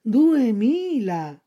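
slow drawn-out cat echo. Acted. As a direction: stretch the word like a lazy cat. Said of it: "ripeté il gatto".

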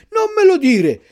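loud, very high frightened cry; terrified shout. A narrator's reading. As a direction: Pinocchio still shaking with fear. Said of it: "urlò Pinocchio che tremava ancora dalla paura". (urlo terrorizzato)

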